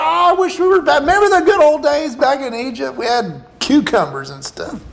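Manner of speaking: hammed-up nostalgic complaint; mock grumbling. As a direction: imitate complainers pining for the old days. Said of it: "they're grumbling, complaining".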